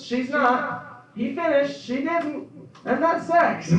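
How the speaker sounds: high, loud rapid race call; horse race announcer. Retold or imitated. Comic spoofing a track announcer calling a sex scene, frantic race call. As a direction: call it like the horses are on the final stretch. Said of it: "they talk like this".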